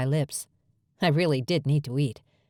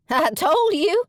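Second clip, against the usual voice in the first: much higher and louder triumphant squeal; 13 semitones higher, 5 dB louder, slower than usual. Acted. Maven gloating to her twin sister, squealing. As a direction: crow it gleefully. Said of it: "Maven squealed".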